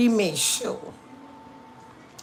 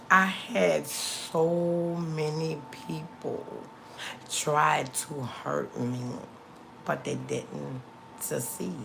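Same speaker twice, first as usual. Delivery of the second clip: drawn-out, measured restatement with a long SO; very slow, deliberate delivery; slow emphatic repeat; 2 semitones lower, 5 dB quieter, slower than usual. Real, not acted. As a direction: stretch each word and pause between phrases. Repeats statement slowly for emphasis.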